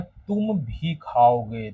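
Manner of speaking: तुम punched, the whole line slow and drawn out; teacher leaning on तुम in "तुम भी खाओगे"; stress on तुम. Shown as a demonstration. Teacher showing the class: तुम said with weight. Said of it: "तुम पर जोर दे रहा है".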